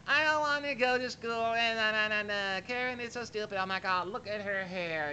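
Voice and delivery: put-on high whiny teen girl voice; whiny teenage girl. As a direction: whine like a sulky teenager. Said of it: "that kind of whiny sound".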